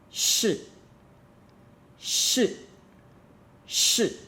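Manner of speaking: "Shi" starting high, dropping fast; short falling "Shi", each one separate; angry fourth tone. Shown as a demonstration. Teacher drilling the word for learners, angry fourth tone.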